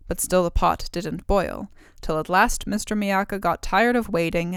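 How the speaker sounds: plain reading voice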